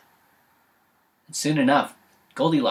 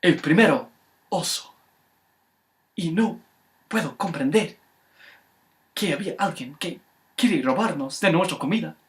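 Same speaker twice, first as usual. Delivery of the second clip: higher, louder put-on Spanish rant; mock Spanish bear; 6 semitones higher, 5 dB louder than usual. Papa bear complaining about thieves, in Spanish. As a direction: rant in fake-Spanish indignation.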